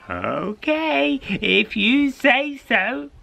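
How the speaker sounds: put-on high, slow old woman's reply; old lady. Old lady giving in to the cat, high and slow. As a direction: shrug it off like an old crone.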